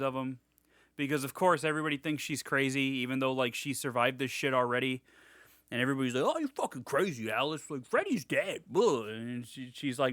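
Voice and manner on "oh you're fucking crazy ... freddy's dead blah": mock them in an exaggerated put-on voice; dismissive, hammed-up scoffing; mocking doubters.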